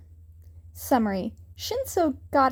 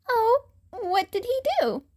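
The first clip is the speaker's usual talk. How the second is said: put-on young boy voice; 10 semitones higher than usual. A narrator's reading. Newcomer voiced, questioning bullies.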